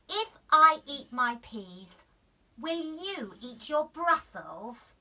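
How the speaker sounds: loud, slow sly deal; cheeky bargain. A narrator's reading. Daisy bargaining back with mum. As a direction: turn the tables. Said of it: "said Daisy".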